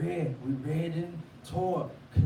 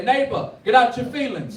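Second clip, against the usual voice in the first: higher, louder call-out; call to the congregation; 9 semitones higher, 8 dB louder than usual. Shown as a demonstration. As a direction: lead the crowd in the phrase. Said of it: "Look at your neighbor, say".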